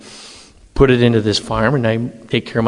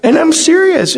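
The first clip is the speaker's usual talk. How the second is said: rising higher, disbelieving objection; mock-shocked pleading with Jesus; incredulous protest; 8 semitones higher, 4 dB louder than usual. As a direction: push back louder at the absurd request. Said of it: "I'm like".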